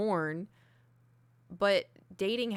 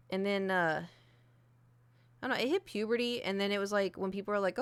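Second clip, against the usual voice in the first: usual speaking voice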